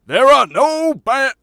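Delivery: a loud revival preacher starting a sermon. Acted. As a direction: launch into the preacher character. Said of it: "he's sort of a preacher".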